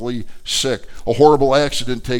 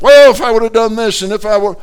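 anguished what-if; 9 semitones higher, 11 dB louder, faster than usual. Retold or imitated. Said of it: "It's like".

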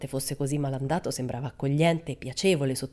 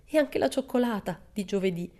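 imploring; 5 semitones higher than usual. Acted. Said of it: "disse implorante".

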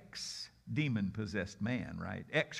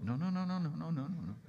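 dismissive refusal; 3 semitones higher, faster than usual. Acted. Jesus stopping Peter from arguing, quick refusal. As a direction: brush off the objection in a rapid string.

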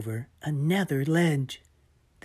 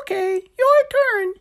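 yelling; 10 dB louder than usual. Acted. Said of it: "he yelled".

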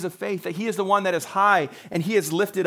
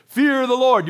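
imitated preacher's warning, raised and louder; pastor's warning; 5 semitones higher, 5 dB louder than usual. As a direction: proclaim it like a pastor from the pulpit. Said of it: "when you hear a pastor say".

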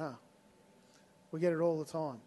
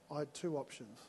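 ordinary talk

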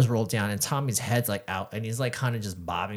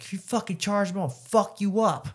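raised pitch, acted-out threat; retold drunken threat; 8 semitones higher than usual. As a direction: act out warning off the driver, slowing at the end.

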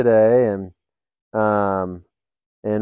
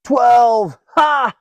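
higher, louder call with a laugh; prisoner calling out; 11 semitones higher, 5 dB louder than usual. Retold or imitated. Another prisoner calls a joke number, loud and higher. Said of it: "another prisoner says".

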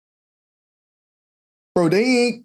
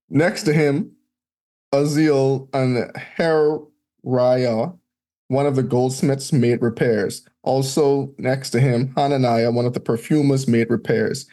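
scripture reading; 6 semitones lower than usual. Acted. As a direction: read out the old names like a lector. Reads scripture list aloud.

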